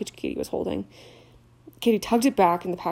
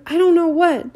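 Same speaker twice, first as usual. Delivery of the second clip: higher, louder distraught sob; sobbing; 10 semitones higher, 9 dB louder than usual. Acted. Leanne to Hagrid, sobbing. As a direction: cry it out in distress. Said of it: "sobbed Leanne".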